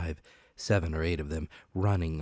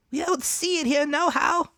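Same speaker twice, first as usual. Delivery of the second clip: pirate reply; 7 dB louder than usual. Acted. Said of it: "returned the man".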